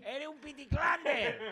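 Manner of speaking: high, drawn-out comic catchphrase; high, slow comic impression; Chiquito de la Calzada. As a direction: stretch it out in a high manic comedian voice. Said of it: "¿cómo imites a Chiquito?".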